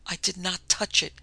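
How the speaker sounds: ordinary delivery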